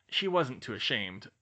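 usual speaking voice

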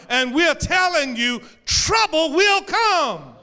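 loud, high proclaiming, slowing at the end; preaching at full force. Acted. Preacher voicing Jesus to the church, proclaiming. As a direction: declare it over the congregation.

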